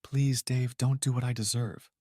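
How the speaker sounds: a touch of a tearful, quiet plea; soft sobbing plea. Acted. Wife pleading with husband Dave, softly tearful. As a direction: beg him gently through held-back tears. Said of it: "she said softly between quiet sobs".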